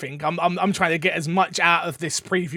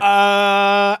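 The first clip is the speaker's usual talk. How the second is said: caught-out uh; 3 semitones higher, 6 dB louder than usual. Retold or imitated. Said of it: "And I'm like".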